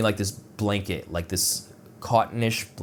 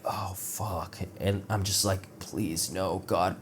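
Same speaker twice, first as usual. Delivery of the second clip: dreading; 8 dB quieter, slower than usual. Retold or imitated. Dreading the guard's order.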